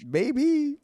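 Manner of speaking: a very high, loud squeak of a maybe; a playful, hammed-up squeak; squeaky maybe. Answering Renee about ice cream, squeaky and high.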